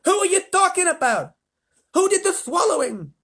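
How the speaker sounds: high, loud frantic questions; yelling. A narrator's reading. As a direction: yell questions in disbelief. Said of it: "yelled the president".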